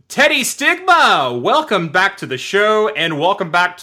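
big announcer welcome, the name high and loud; show announcer welcome. Acted. Host welcoming Teddy Stigma back to the show, hyped announcer style. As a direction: draw out the guest's name, then roll into the welcome.

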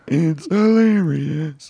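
slow, stiff robot voice. Retold or imitated. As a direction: drag it out like a robot. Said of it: "he kinda sound like".

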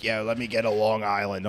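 low, lightly mocked Philly accent; imitated Philly accent. Bar customer ordering a drink, imitated Philly accent. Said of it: "there's this one guy who comes into the bar".

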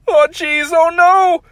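high, loud frightened cry; panicked Morty. Acted. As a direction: yelp it in panic.

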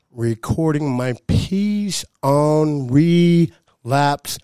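slow drawn-out build to the punchline; dramatic slow reveal. Acted. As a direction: stretch each word for effect.

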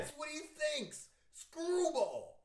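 squeaky cartoon voice; silly high voice. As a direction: pitch it way up. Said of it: "thought bubble of a screw".

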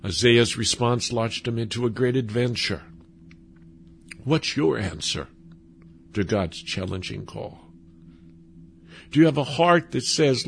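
ordinary talk, nothing marked

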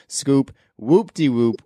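slow, sing-song whoop-de-whoop; drawn-out whoop-de-whoop. Shown as a demonstration. Hosts announcing their rating scale, slow sing-song. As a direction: stretch out whoop-de-whoop playfully. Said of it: "Then we decide if it's poop".

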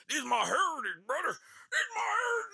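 high, strained caricature of a bigot; hammed-up outraged bigot squeal. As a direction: escalate into a shrieked heritage cry.

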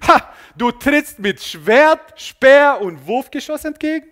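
loud, slow, scornful battle challenge; defiant callback. Acted. David calling back to Goliath across the battlefield, defiant. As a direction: throw the taunt back, drawn out and loud.

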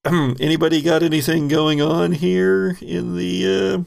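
ordinary delivery

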